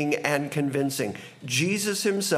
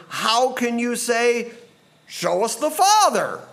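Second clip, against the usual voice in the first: incredulous challenge; 6 semitones higher, 4 dB louder than usual. Acted. Jesus questions Philip's request to see the Father, incredulous.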